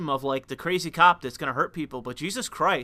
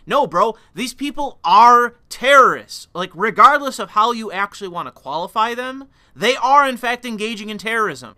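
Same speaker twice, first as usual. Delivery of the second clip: heated outburst; 5 semitones higher, 8 dB louder than usual. Real, not acted. Heated rant against calling them anything else.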